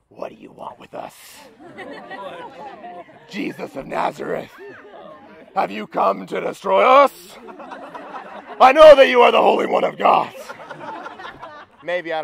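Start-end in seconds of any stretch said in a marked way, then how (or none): 0.1-10.3 horror-movie demon, voiceless rasp building to a loud roar. Acted. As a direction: start as a hushed rasp, swell into a demonic shout. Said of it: "this man with a demon inside him speaks up".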